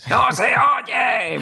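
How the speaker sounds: high shout; shouted complaint. Retold or imitated. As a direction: yell it like an old man who can't hear. Grandpa Simpson meme shout.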